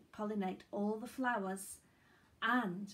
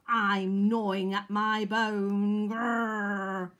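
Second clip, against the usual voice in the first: loud, slow doggy grumble ending in a growl; growling grumpy dog; 10 dB louder, much slower than usual. A narrator's reading. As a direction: growl it like a dog guarding a bone. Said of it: "growled a grumpy dog".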